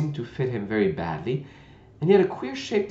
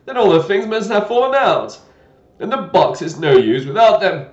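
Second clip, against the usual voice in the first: loud, a touch of vexation; mildly annoyed old knight; vexed knight; 8 semitones higher, 11 dB louder than usual. Acted. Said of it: "a shade of vexation".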